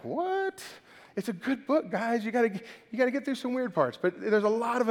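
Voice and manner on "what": very high, squeaked mock surprise; mock disbelief. Congregation hearing they must turn to Leviticus, mock disbelief. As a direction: imitate a baffled listener, pitch shooting way up. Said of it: "I know, you're like".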